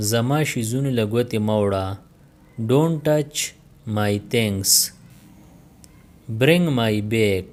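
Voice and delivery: normal talking voice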